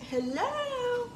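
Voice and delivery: sing-song high phone greeting; high hello. Retold or imitated. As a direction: float the second hello up high. Answering unknown caller, second try.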